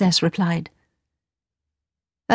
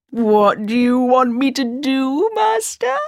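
genie; 5 semitones higher, slower than usual. Acted. Genie speaks to master.

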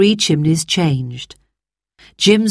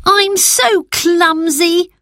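put-on high, slow rueful sigh of a small girl; a fallen child sighing at herself; 12 semitones higher, 3 dB louder, much slower than usual. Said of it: "sighed Phyllis".

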